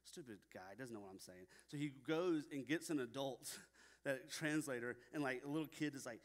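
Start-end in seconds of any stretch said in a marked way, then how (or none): 0.0-1.5 much softer, lower mock grumble from a frustrated kid; muttering kid. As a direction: mutter it under your breath like an annoyed child. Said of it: "but he's probably like".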